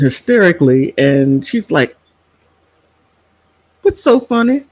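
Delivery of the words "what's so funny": high-pitched, puzzled mom question; imitated mother. Elderly mother asks her laughing daughter, puzzled imitation.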